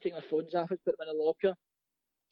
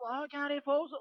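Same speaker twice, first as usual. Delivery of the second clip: high mock crowd greeting; put-on high-pitched delighted recognition; cheering prisoners; 9 semitones higher than usual. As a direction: imitate inmates hailing a celebrity. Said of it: "they're all clapping".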